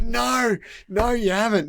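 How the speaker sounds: high-pitched mock protest; retold disbelief. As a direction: act out refusing to believe your mate. Retold disbelief at mate's boast.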